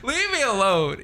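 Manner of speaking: high, drawn-out mock whine of exasperation; exasperated. Replying to friends who trash on you, exasperated. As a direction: stretch it out high, playing fed up.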